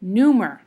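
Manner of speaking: "Neumer" as NEU-mer; strong first syllable in "Neumer", light "mer"; stress on first syllable. Shown as a demonstration. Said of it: "neum is the stressed syllable".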